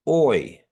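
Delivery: put-on teacher names the vowel and says oi like boy; Kiowa vowel oi. Shown as a demonstration. Teacher models oi for the class, like boy. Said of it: "Almost like boy".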